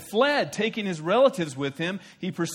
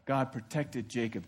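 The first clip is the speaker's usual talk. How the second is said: ordinary talk, nothing marked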